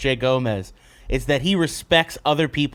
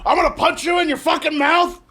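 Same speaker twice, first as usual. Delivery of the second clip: hammed-up angry shout, pitched higher; mock furious yell; 12 semitones higher than usual. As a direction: blow up at a friend, much higher and full voice. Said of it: "And then you're like".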